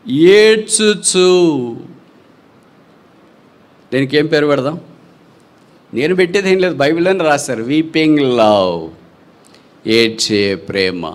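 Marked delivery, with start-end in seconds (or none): 0.1-1.8 very slow, stretched ఏడ్చుచు; slow mournful stretch on the word; drawn-out weeping word. Acted. Preacher dwells on weeping. 7.6-11.1 slow, lower naming of the love; slow pulpit emphasis; slow, weighty title. Acted.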